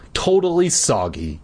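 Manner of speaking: plain reading voice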